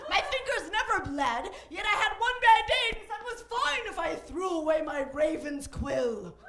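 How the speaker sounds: put-on lamenting in a high, loud British accent; hammed-up British grievance. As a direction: pour out the woe, theatrically British. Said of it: "I don't know why he's British".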